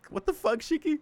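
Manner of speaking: high startled outburst; shocked. Real, not acted. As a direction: blurt it out in shock. Shocked reaction to kick.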